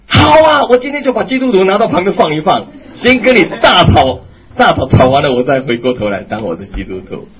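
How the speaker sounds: loud, high outburst of pent-up anger; exploding wife. Retold or imitated. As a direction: finally snap and let it all out. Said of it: "再也止不住了". (忍无可忍地高声爆发)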